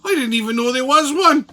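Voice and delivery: high, loud incredulous retort; exaggerated mock disbelief; mock clueless politician. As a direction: play the oblivious official, pitched high. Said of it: "and he goes".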